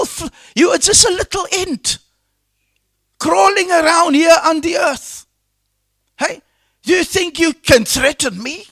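high, incredulous taunt in God's role; preacher acting out divine scorn; God's scoffing words. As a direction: scoff at a puny challenger, pitched high. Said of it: "The Lord says".

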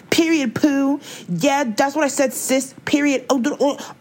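high-pitched mock sassy influencer; high mimicking squeal of trendy slang. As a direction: put on a high, exaggerated slang voice to mock them.

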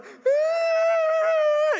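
long, very high mock scream; exaggerated loud squeal; crying wail. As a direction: imitate an exhausted kid wailing as he runs. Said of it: "he's wheezing and he's crying".

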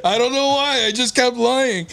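high-pitched, baffled protest; exasperated confession. Real, not acted. Teller confessing pointless lies to friends, exasperated. Protesting his own compulsive lying.

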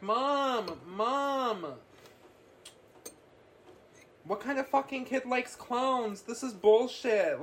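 hammed-up kid yelling for mom, no real volume; mock panicked kid. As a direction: playfully voice a freaked-out boy screaming for mom. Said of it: "screaming. He's like".

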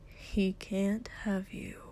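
soft, slow whisper; slow gentle whisper; too gently. Acted. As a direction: breathe it tenderly, barely voiced. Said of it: "he said too gently".

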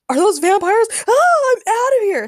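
very high, loud alarm; panicked scream. A narrator's reading. Spider-Man spots vampires and flees, screaming. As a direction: shriek and bolt in terror. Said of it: "Spider-Man screamed as he scooped up".

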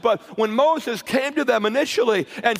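rising, shouted preacher build; preaching at full force. Real, not acted. Preacher building the camp meeting up, full shouting. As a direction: drive the story up to a shout.

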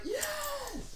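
very high, squealed cheer; mock fan cheer. Fan cheering a favorite movie moment, mock thrilled squeal.

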